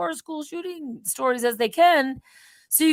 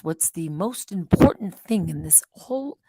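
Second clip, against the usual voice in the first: whispered quote; 8 semitones lower than usual. Retold or imitated. Quoting interviewer, whispered.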